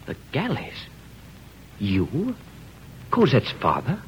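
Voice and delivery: breathless, half-whispered shock; shocked disbelief. Acted. Husband stunned to learn his father-in-law is a convict, whispered shock. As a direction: gasp out the word in disbelief.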